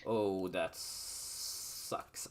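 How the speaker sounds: normal talking voice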